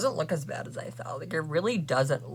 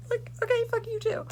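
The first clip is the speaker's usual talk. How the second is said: much higher, sing-song okay; squeaky sarcastic comeback; sarcastic high retort. Real, not acted. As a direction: toss it back with mock sweetness.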